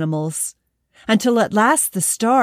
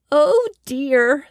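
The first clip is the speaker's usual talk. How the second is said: high, louder squeak of a tiny hedgehog; squeaky hedgehog; 7 semitones higher, 4 dB louder than usual. Acted. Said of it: "the little hedgehog squeaked".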